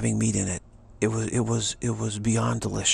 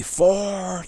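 a sharp, raised golf call of "Four"; retold scream; 9 semitones higher, 5 dB louder than usual. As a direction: yell it like a golfer demanding the way clear. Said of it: "he screams at me".

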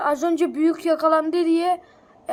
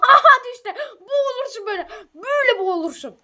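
excited alarm; 8 semitones higher, 6 dB louder than usual. Real, not acted. Character fell into water.

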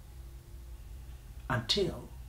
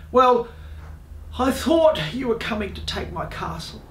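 higher voice with a hint of huffy king; lightly voiced flustered monarch; defensive king; 9 semitones higher than usual. A narrator's reading. King defends himself to dragon.

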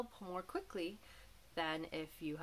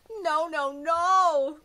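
much higher, much louder panicked no's; crying out; 9 semitones higher, 12 dB louder, much slower than usual. A narrator's reading. Mom cries out as the moose wrecks the house, high and loud. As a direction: shriek the no's in rising alarm. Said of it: "cried Mom".

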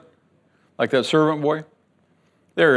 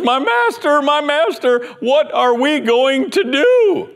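much higher, slow, frantic cry; panicked servant; 10 semitones higher, 4 dB louder than usual. Acted. Frightened servant running back to Elisha, much higher and panicked. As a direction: wail my master in terror.

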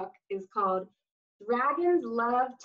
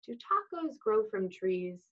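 plain reading voice

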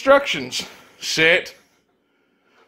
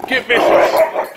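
vicious voice; 6 semitones higher, 4 dB louder, slower than usual. Pretend. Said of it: "Show us your viciousness".

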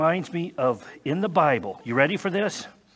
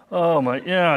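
ordinary talk, nothing marked

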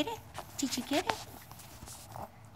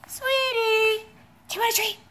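higher, quick sing-song offer; 7 semitones higher than usual. Real, not acted. Owner offering the dog a treat again, high baby talk. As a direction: perk it up like talking to a puppy.

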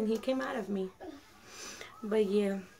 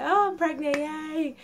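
higher, louder mock celebration; pretend-excited, pitched up; mock cheery announcement; 7 semitones higher, 5 dB louder, slower than usual. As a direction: announce it with a playful little yay. Imagined cheery announcement to her mother.

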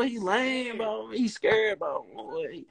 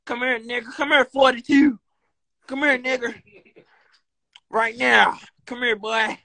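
calling out; 7 semitones higher than usual. Real, not acted. Streamer calling a friend over, hollering. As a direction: keep hollering for him to come here.

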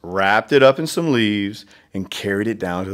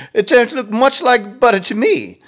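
teasing Raccoon; 11 semitones higher, 3 dB louder than usual. Acted.

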